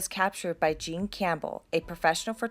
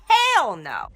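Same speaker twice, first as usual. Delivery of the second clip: loud exclamation; 4 semitones higher, 8 dB louder than usual. Real, not acted. Reacting to ghost vanishing.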